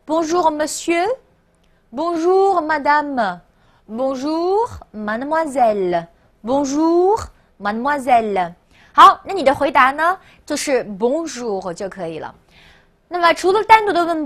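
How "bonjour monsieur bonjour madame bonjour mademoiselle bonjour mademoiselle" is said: measured model reading with rising Bonjour; read-along demo. Shown as a demonstration. Teacher has the class repeat greetings, careful read-along. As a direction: say each greeting carefully, pausing for learners to repeat. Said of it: "跟我一起来一遍".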